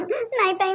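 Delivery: slow, sobbing start to the line; a wife's mock sob on the phone to her sister-in-law; acted sob into words. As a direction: break into a staged sob, then speak slowly. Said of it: "तू रडणं बंद कर बरं पहिले".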